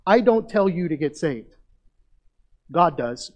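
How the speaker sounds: loud, forceful declaration to the congregation; emphatic preaching. Real, not acted. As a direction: drive it home from the pulpit, loud. Preacher corrects who does the saving.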